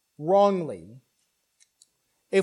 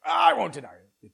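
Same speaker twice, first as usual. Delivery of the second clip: Peter protesting; 4 semitones higher, 4 dB louder, faster than usual. Retold or imitated. Peter insisting again.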